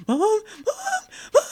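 high, loud crying scream for mom; panic screaming. Retold or imitated. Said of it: "really good panic screaming".

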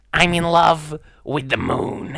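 high, slow, dreamy put-on voice; Uncle Fester voice. Trying out a Fester voice for the musical, slow and high. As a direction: drawl it out like a strange bald uncle. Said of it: "Doing a Festa voice would be fun".

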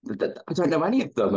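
blurting it out, caught off guard at a test; retold panic. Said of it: "I was like".

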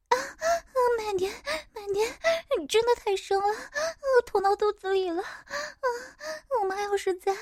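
high moaning character voice; breathless mother character.

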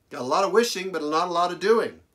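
quick, high, laughing aside; amused laugh. Real, not acted. Preacher joking to the congregation about his own failings, laughing. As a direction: laugh at yourself and rattle it off.

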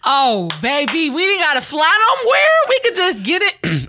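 loud and high, thrilled; excited. Real, not acted. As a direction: shout it with delight. Excited at VR travel.

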